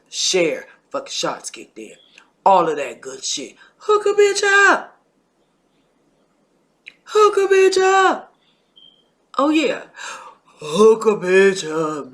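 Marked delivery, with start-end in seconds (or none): 3.8-5.0 high, loud pushy auntie plea; Auntie Pat voice. Retold or imitated. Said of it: "In my Auntie Pat voice". 7.0-9.8 high, pushy auntie voice begging for likes; Auntie Pat voice. Retold or imitated. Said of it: "In my Auntie Pat voice". 10.4-12.1 slow, drawn-out auntie voice at normal pitch; Auntie Pat voice. Retold or imitated. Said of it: "In my Auntie Pat voice".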